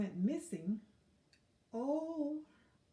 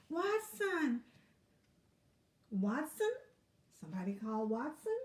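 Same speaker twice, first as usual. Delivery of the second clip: squirrel calling out; 3 dB louder than usual. Acted. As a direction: call out urgently across the forest. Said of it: "a nearby squirrel cried out".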